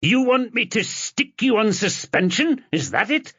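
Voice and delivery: raging, pitched high; furious boss. A narrator's reading. Boss threatening suspension in a furious high shout. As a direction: bellow it at the rogue officer. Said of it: "Doherty's head was shaking with rage".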